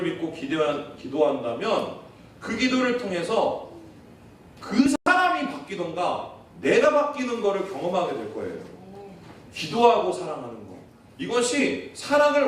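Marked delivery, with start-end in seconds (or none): none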